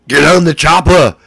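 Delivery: over-the-top Austrian strongman impression; Arnold Schwarzenegger. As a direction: bellow it with a thick Austrian accent. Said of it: "It's Arnold Schwarzenegger".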